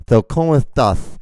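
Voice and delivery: "So, ¿Cómo estás?" with s as "th"; overdone lisp on "estás"; mock lisp. Showing how they talk in Spain, heavily lisped. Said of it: "So they talk like this".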